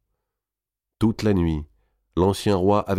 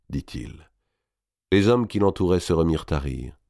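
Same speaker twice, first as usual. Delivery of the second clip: usual speaking voice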